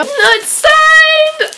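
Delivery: sudden startled cry; surprised shout; shocked. Real, not acted. Surprised book not signed.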